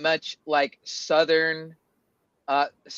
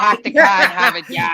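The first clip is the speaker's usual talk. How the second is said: put-on broad Boston accent, pitched up and laughing; thick Boston accent; 3 semitones higher, 8 dB louder than usual. Denying they sound that intense, hammed-up Boston accent. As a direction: exaggerate a heavy Boston accent. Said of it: "I don't really sound that intense".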